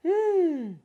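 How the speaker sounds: put-on high, loud drawn-out hum of a child; reluctant hum.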